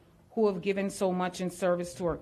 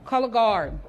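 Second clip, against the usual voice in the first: loud ceremonial order; ordering loudly; 3 semitones higher, 7 dB louder than usual. Real, not acted. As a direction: call it out across the hall. MC gives order to color guard.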